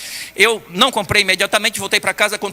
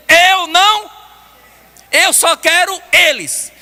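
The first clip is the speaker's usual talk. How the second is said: higher, slow heartfelt insistence; Jesus insisting he wants only humanity, slow and higher; earnest plea; 9 semitones higher, 6 dB louder, much slower than usual. Retold or imitated. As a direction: hold on to them with love. Jesus refusing, voiced slowly.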